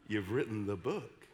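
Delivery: low, slow minister's voice; imitated solemn pronouncement, pitched low; woman minister. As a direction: intone it weightily, phrase by phrase. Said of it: "woman minister, came up to me".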